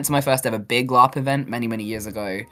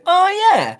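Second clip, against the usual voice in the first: much higher, louder mock realisation; acted realisation; 6 dB louder than usual. Retelling a friend's pep talk landing, high dawning realisation. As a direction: say it like the penny just dropped.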